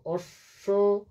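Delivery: অশ্ব said very slowly, ending open on o: ossho; slow drawn out অশ্ব. Shown as a demonstration. Teacher models অশ্ব for the class, very slow and careful. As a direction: stretch অশ্ব out syllable by syllable. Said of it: "লিখেছেন".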